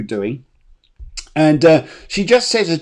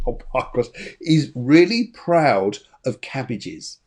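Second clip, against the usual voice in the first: ordinary talk, nothing marked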